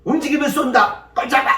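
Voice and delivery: high, sharp sentry's shout; loud armed-guard warning; barked challenge. Acted. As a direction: order an intruder to freeze. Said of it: "보통 사람 같으면".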